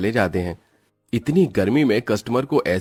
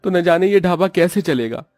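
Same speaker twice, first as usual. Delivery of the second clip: higher, gloomy husband fearing the shop will close; despairing dhaba owner; 8 semitones higher, 4 dB louder than usual. Acted. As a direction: fret aloud in a high voice to Sapna. Owner despairs over no customers.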